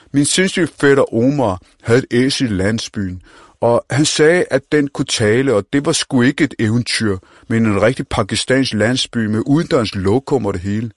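put-on cocky street-kid voice; brash young slang talker; Mohammed. Mohammed tells about cousin Omar.

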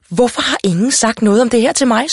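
high-pitched exasperated mother; indignant outburst. A narrator's reading. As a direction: demand to know, upset and exasperated. Mother demands why nobody told her.

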